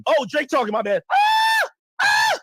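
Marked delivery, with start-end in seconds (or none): 1.1-2.4 mock fangirl scream. Mock fan screaming at [name].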